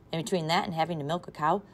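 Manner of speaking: usual speaking voice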